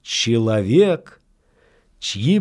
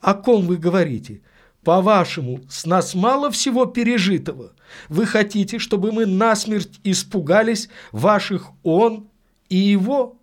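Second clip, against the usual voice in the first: high, fast, indignant questioning; impatient passion; 8 semitones higher than usual. A narrator's reading. Lady challenging the priest about frightening them, impatiently passionate. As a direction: fire questions with impatient fervour. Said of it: "с нетерпеливой страстностью потребовала ответа".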